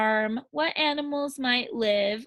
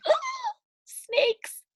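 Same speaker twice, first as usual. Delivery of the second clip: surprised. Pretend. Sudden excited remembering.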